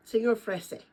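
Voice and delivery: usual speaking voice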